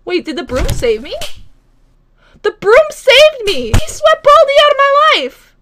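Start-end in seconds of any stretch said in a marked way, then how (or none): 2.4-5.6 excited relief. Real, not acted. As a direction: celebrate being saved.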